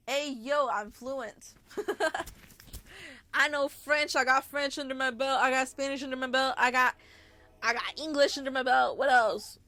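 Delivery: loud, hyped-up boasting; loud joking boast about languages; mock bragging. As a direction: brag it like a show-off. Boasting about being fluent.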